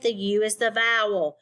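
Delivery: normal talking voice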